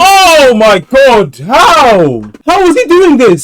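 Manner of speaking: drawn-out, loud cries of disbelief; appalled, high-pitched yelling; shocked outburst. Real, not acted. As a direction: shout it in high, stunned bursts. Shocked reaction to death news.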